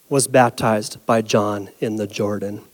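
hushed. Shown as a demonstration. Preacher contrasting humble Jesus with the crowd, hushed. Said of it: "a striking but deliberate contrast".

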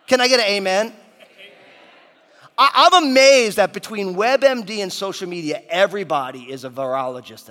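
booming motivational speech. Acted. Calls the congregation for amen.

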